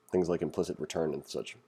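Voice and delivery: ordinary talk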